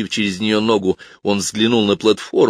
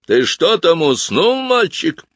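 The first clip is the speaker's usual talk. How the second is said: much higher and louder, booming; thundering piper; 10 semitones higher, 4 dB louder, slower than usual. Acted. Piper booms impatiently at the silent boy. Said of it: "прогремел голос дудочника".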